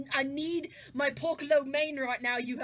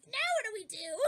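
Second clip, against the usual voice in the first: higher voice for the ones left behind; helpless character voice; 7 semitones higher than usual. Acted. Household left at home wondering, high helpless voice. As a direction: pitch it up, half laughing.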